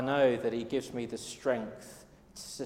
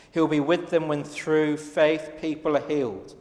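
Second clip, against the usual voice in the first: preaching refrain; 2 semitones higher, 5 dB louder than usual. Real, not acted.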